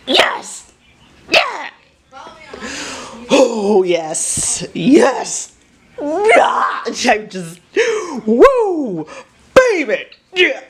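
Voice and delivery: high, whooping bursts of joy; so excited. Real, not acted. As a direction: celebrate in short, high whoops. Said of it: "like, so excited".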